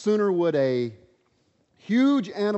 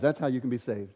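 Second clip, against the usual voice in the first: usual speaking voice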